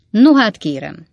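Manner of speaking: loud, sharp protest; sharp retort. A narrator's reading. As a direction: cut in sharply, with force. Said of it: "felelte Weller úr éles hangon".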